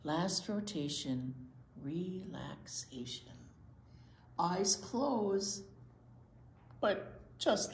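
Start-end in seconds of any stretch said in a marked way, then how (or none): none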